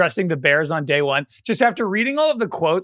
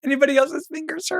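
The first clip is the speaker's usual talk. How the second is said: mock coach threat; 10 semitones higher than usual. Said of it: "He's like".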